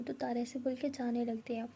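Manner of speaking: ordinary delivery, nothing marked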